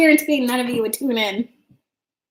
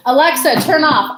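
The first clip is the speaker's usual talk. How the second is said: raised voice commanding the speaker; calling out to Alexa; 4 semitones higher, 5 dB louder than usual. Real, not acted. Streamer shouting at her Alexa, raised and commanding. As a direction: snap the command at the device.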